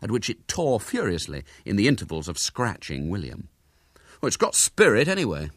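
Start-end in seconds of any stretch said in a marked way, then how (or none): 4.2-5.5 higher, proud defence. A narrator's reading. William defends the wild-tempered cat, proud. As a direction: stand up for it proudly. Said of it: "said William proudly".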